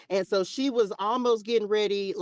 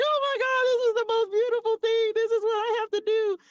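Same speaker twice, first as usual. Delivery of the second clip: much higher, breathless wonder; awestruck. Retold or imitated. Student nurse after seeing her first birth, much higher and awestruck. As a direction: gush as if you've found your calling. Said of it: "I was just like".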